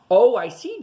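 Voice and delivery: light up with understanding; pleased realization. Acted. Man realizing George makes cards, bright pleased realization. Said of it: "said his friend".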